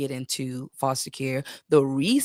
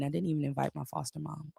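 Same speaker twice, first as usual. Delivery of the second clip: normal talking voice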